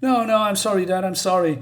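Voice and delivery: hammed-up frantic sorry; upset, retold. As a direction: retell a distraught boy apologizing to his dad. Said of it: "more upset than ever".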